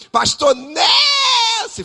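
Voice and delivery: very high, slow shout with a cry in it. Retold or imitated. Makeup artist insisting over the room, crying out very high. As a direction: yell his name even harder, stretched. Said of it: "Falando alto".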